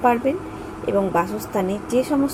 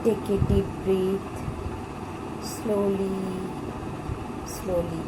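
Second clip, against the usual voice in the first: softer, slower voice with long pauses between phrases; guided meditation; 3 semitones lower, 9 dB quieter than usual. Acted. Guide leads listeners in thanks and divine healing, meditative. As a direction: lead listeners gently through gratitude and prayer, leaving space.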